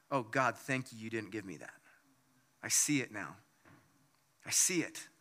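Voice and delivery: low, soft, reflective thanks; hushed grateful prayer. Retold or imitated. Man looking back thanks God for saying no, hushed and grateful. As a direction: quietly voice a dawning realization to God.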